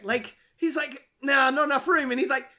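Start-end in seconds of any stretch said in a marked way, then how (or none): 1.2-2.1 higher, louder dopey excuse; quoted Tyrone. Retold or imitated. Host voicing Tyrone's excuse, high and loud. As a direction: answer like a dim getaway driver.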